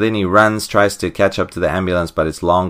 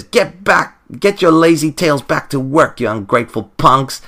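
scolding villainess; 8 semitones higher than usual. Retold or imitated. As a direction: bark them back to work. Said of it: "she says".

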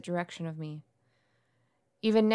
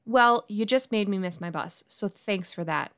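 ordinary talk, nothing marked

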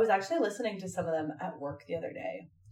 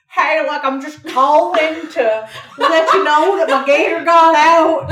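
mock the voicemail guy, louder and higher; 8 semitones higher, 13 dB louder, slower than usual. Imitating the gator voicemail skit for a friend. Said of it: "and then be like".

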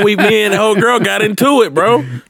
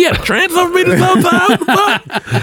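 mock outrage; 12 semitones higher than usual. Acting outraged reply, high voice.

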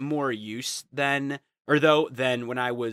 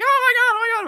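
mock fan shriek; 11 dB louder, faster than usual. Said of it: "being like".

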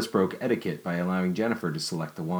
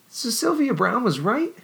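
high, breathless wonder rising; wondering young woman; 4 dB louder, slower than usual. A narrator's reading. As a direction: pile up the questions in awe. Jennifer excited.

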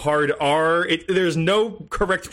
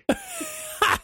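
squealing laugh; high laugh; 3 dB quieter than usual. Real, not acted. Laughing at the drunk voice.

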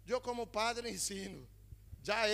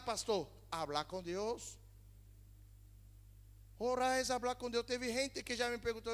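ordinary delivery

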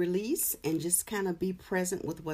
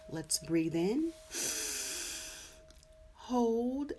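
slow breath cue; 6 semitones higher, much slower than usual. Shown as a demonstration. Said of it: "And so let's begin".

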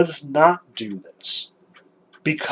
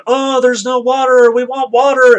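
much higher, whiny complaining voice; mocking complainers in a high nasal whine; whining Israelites; 9 semitones higher, 4 dB louder than usual. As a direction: whine like a hungry grumbling crowd.

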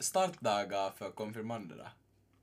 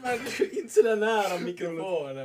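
laughing; 8 semitones higher, 5 dB louder than usual. Real, not acted. Mocking a too-close mic voice.

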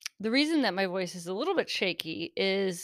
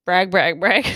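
mock bragging; 10 dB louder than usual.

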